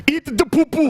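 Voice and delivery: African dialect imitation. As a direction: imitate the video guy's accent. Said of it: "one of 'em sounds like".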